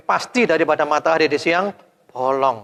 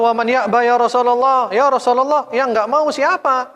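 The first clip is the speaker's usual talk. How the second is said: much higher, louder questioning; companions asking eagerly; 10 semitones higher, 4 dB louder than usual. Acted. Companions asking the Prophet who refuses, high and loud. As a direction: call out the question in surprise.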